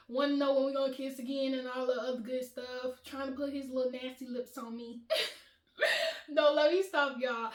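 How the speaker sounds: ordinary delivery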